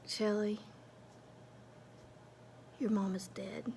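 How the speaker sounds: soft whispered hard truth; gentle whisper. Retold or imitated. Therapist telling Shelly a hard truth, gentle whisper. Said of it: "she's like".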